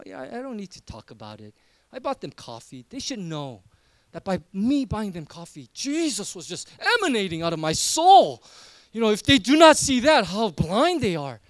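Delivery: smug, lightly hammed-up churchgoer; a hint of a pious know-it-all brushing it off; mock self-righteous. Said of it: "put on this almost self-righteous".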